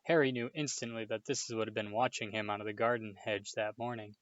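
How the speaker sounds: ordinary delivery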